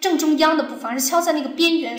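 ordinary delivery

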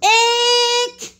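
high, loud, long-held howl; loud high-pitched child's howl. A narrator's reading. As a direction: howl it out like an overeager pupil. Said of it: "howled Amelia Bedelia".

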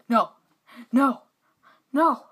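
loud, high no; distressed shout. Real, not acted. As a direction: yell in dismay. Losing in game.

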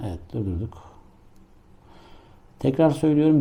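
normal talking voice

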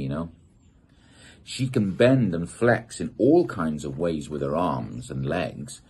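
ordinary delivery

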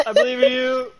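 very high, loud encouraging squeal; squeaky mock cheer. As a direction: cheer in a silly falsetto. Cheering friend on.

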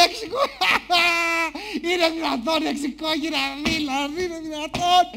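high, helpless acted laughing voice; crying with laughter. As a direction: play an audience too tickled to finish. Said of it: "έχει πέσει να δακρύζει από τα γέλια".

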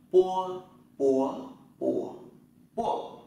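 "pua" with unaspirated p, loud and high, four tones; Chinese pinyin drill. Shown as a demonstration. Teacher drilling students through "pua". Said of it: "Ta thử nhé".

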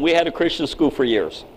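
usual speaking voice

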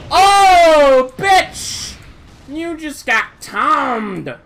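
taunting yell. Real, not acted. Taunting after a kill.

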